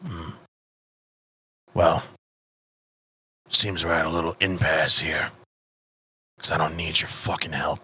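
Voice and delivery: low, quiet contempt; cold assassin refusal. Acted. Assassin rejecting the player's help, low and scornful. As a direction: brush him off with low scorn.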